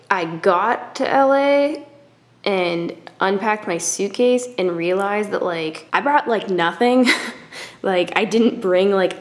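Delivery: ordinary delivery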